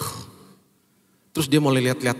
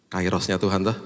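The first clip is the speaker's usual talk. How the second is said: usual speaking voice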